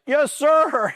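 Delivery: high-pitched strained recruit shout; comically high mock acknowledgement; recruit sounding off. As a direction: imitate a desperate recruit answering an instructor. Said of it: "that recruit sounds off".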